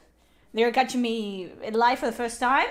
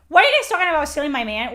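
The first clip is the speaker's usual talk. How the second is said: fast, loud mock accusation; mock outrage; 3 semitones higher, 6 dB louder, much faster than usual. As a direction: play at being offended.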